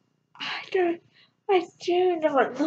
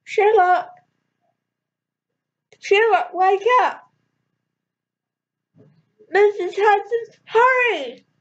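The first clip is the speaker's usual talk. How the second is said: yelled; 5 semitones higher than usual. A narrator's reading. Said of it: "I yelled".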